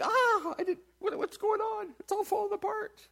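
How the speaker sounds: high squeaky mock panic; hammed-up startled voice; mock panicked God. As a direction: play God flustered for a laugh. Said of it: "God's like".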